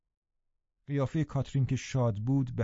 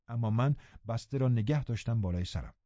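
plain reading voice

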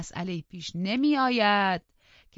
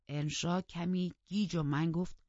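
ordinary delivery

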